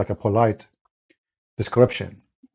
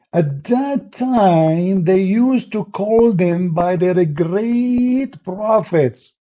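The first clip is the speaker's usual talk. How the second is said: slow, higher, drawn-out mock explanation; quoted explanation; 6 semitones higher, much slower than usual. Retelling Muhammad's excuse to listeners, slow higher mock quote. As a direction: stretch it out slowly in a higher mocking voice. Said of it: "So he said".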